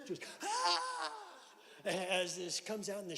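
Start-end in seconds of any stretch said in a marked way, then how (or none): 0.4-1.1 very high, loud mock scream; acted falling scream; imitated god-awful scream. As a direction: shriek like a terrified skydiver. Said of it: "you just hear this god-awful scream".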